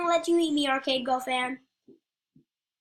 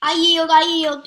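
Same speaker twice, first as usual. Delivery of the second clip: shouted, much louder; loud surrender; 3 semitones higher, 11 dB louder than usual. Real, not acted. Gamer shouting to the other players that he surrenders, loud.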